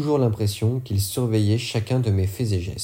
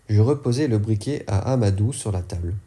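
plain reading voice

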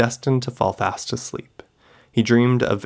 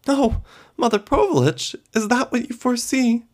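much higher, eager delight; 13 semitones higher than usual. Acted. Katya thrilled by her foretold daughters, delighted. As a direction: gasp it out in wonder. Young woman delighted by fortune.

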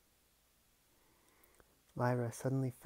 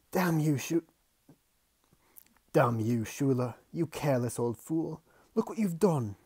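angry shout; 3 semitones higher, 9 dB louder than usual. Acted. Uncle shouts at porter.

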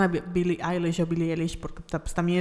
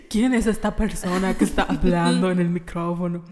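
laughing disbelief; 3 dB louder than usual. Retold or imitated. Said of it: "me escucho y digo".

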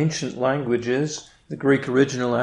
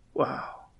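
whispered awe; 9 dB quieter than usual. Real, not acted. Awe at God's love.

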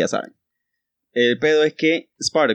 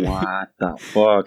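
very slow, lower, stunned what the fuck; stunned drawn-out reaction; 4 semitones lower, much slower than usual. Pretend. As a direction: stretch it out, dumbfounded. Stunned reaction in the dog scenario.

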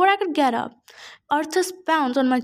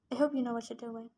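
much softer, hushed and low; said quietly; 3 semitones lower, 12 dB quieter than usual. A narrator's reading. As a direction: keep it down so only he hears. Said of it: "she said quietly".

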